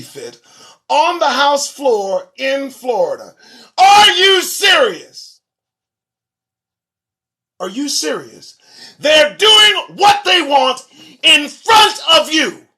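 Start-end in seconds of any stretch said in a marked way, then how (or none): 3.8-5.4 slow, much higher and louder are you serious; incredulous shout. Real, not acted. Disbelief at a news story. 9.0-12.6 higher, louder, slow and emphatic; slow shout. Real, not acted. Ranting at lawmakers.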